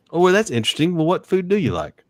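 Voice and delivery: high-pitched, interested follow-up question; acted curiosity. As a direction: play intrigued and draw them out. Acting out curious follow-up after a no.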